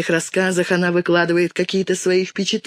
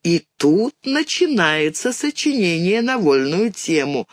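ordinary delivery, nothing marked

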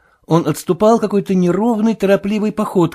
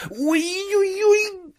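long, high, softer wail; pained moaning; 4 dB quieter, much slower than usual. A narrator's reading. Marvel moaning again to the sailor, pained moaning. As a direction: draw the moan out high and thin. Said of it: "простонал он опять".

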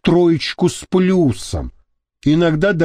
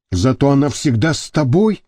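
plain reading voice

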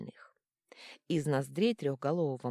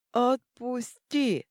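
much higher, louder, slow plea; pleading monster; 8 semitones higher, 6 dB louder, much slower than usual. A narrator's reading. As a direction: beg to be let go. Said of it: "взмолились две головы". (жалобная мольба)

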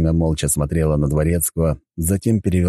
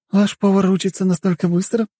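high-pitched surprised question. Acted. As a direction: ask in astonishment, pitched well up. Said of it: "удивилась она". (удивлённо, высоким голосом)

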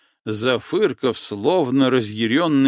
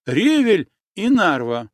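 higher, louder urgent call of the password; 7 semitones higher, 4 dB louder than usual. Acted. Girl urgently repeating the password to the boatman, calling out. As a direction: push the password out, anxious to be believed.

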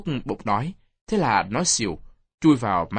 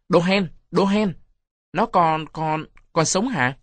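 raised, higher-pitched yell at the cowards; loud shout; 6 semitones higher, 5 dB louder, slower than usual. A narrator's reading. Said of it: "Nó hét lớn". (hét lớn, giọng cao)

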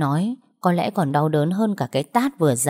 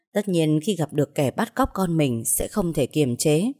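ordinary delivery, nothing marked

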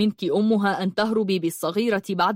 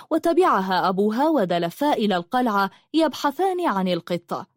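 ordinary delivery, nothing marked

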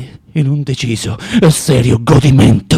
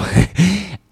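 a brief falling laugh at himself; dry self-mocking chuckle; short bitter laugh; 3 semitones higher than usual. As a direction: scoff at your own joke.

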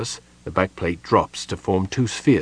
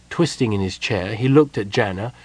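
plain reading voice

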